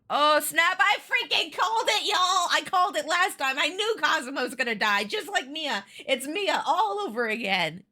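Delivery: high-pitched triumphant "called it"; real excitement, easing off toward the end; excited outburst. Real, not acted. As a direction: crow that you predicted it. Predicted plot twist comes true.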